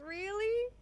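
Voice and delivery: shrill excited really; high surprised squeal. Acted.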